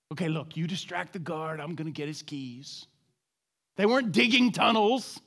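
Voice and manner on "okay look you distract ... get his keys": low, soft aside between plotting prisoners; hushed scheming. Acted. One prisoner plans distracting the guard with another, hushed scheming. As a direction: act out a furtive escape plan, voice kept down.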